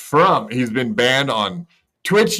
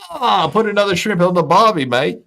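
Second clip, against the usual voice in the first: higher hammed-up Aussie voice; Australian accent; 8 semitones higher, 3 dB louder than usual. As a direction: overdo a bad Australian accent. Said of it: "my horrible impersonations".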